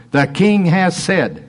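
the king has said, slow, king pressed hard; stress on king. Shown as a demonstration. Preacher showing the captain's claim of authority, stressed. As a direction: lean on king like a royal decree. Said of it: "this is the word of authority".

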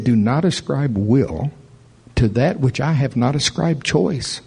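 usual speaking voice

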